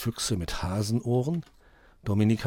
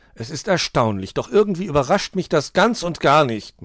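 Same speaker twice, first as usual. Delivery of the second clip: sarcastic grumble building louder and higher; sulky griping; 5 semitones higher, 5 dB louder than usual. A narrator's reading. Dominik hears the spare batteries don't fit, griping. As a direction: gripe with heavy irony. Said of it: "maulte Dominik".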